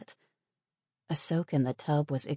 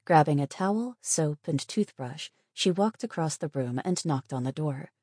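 usual speaking voice

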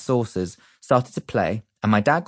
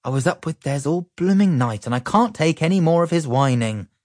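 sighing mum; 8 semitones higher than usual. A narrator's reading. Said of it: "sighed my mum".

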